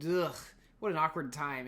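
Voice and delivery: normal talking voice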